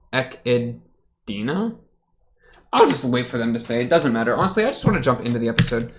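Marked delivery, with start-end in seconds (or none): 0.1-1.7 "Echidna" very slowly as eck-id-DEE-nuh, rising; sounding it out. Shown as a demonstration. Reactor guessing at the name, "Echidna" slowly sounded out as eck-id-DEE-nuh. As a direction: break "Echidna" into slow questioning syllables. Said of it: "if you're here for, like, good Japanese pronunciation".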